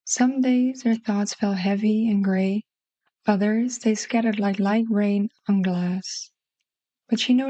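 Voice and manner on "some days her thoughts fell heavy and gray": ordinary delivery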